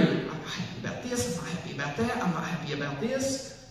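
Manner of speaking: hushed, seething imitation of complaints; angry complaining. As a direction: act out angry scribbling under the breath. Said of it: "just getting angry, angry, angry".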